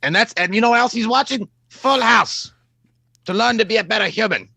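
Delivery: high put-on character voice; Doctor Loomis. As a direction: complain in character, pitched well up. Continues answering as Doctor Loomis.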